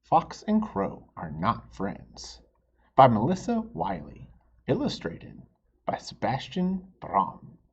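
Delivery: bright, announcing a book title; storybook title read aloud. A narrator's reading. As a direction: read the cover out to the kids. Reads title to children.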